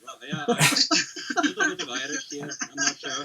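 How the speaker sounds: mock English-Irish accent. Caster tries an English accent for chat, hammed-up English accent. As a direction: put on a muddled English accent, half Irish. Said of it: "I think I'll talk like this".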